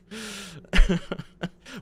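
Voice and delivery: squealing laugh; high laugh. Real, not acted.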